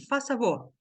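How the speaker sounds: "Faz favor" blended into "fasavô"; everyday Portuguese "Faz favor", sounds swallowed; run-together speech. Shown as a demonstration. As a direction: link "Faz favor" as one word. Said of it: "como a gente fala assim".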